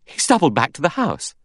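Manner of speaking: Vera arguing. A narrator's reading. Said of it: "Vera said".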